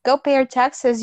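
ordinary talk

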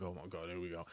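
low, very soft weary sigh; mock exasperated woman, low and quiet; annoyed girl. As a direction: done with him already. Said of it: "She'd be like".

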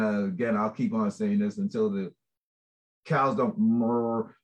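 ordinary talk

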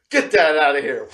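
cocky and loud; playful brush-off. Retold or imitated. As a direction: swat the handshake away with a laugh. Brushing off the handshake.

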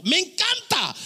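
high-pitched burst of delight; real, gleeful outburst; delighted exclamation. Real, not acted. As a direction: exclaim how much you love it. Delighted exclamation repeating the aside.

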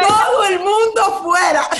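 high, shouted order; commanding teacher. Acted. The Master sends the mourners out of Jairo's house, commanding shout. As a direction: clear the room as the Master would. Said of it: "¿Y qué dice el maestro?".

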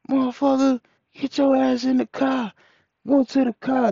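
high-pitched retold command; agitated order. Retold order during a street commotion.

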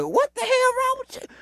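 very high, squeaky mock disbelief; shocked disbelief. Man acting out being cussed out, high-pitched disbelief. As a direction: squeal it like a stunned bystander.

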